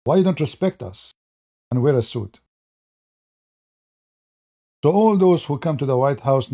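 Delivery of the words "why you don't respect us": normal talking voice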